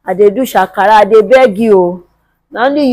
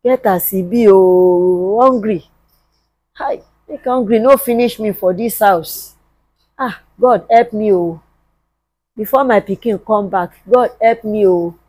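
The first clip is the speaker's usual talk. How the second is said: soft, low muttered prayer; subdued, helpless self-talk; weary hungry lament; 3 semitones lower, 6 dB quieter than usual. Acted. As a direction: grumble quietly, drained by hunger. Hungry, praying alone for help.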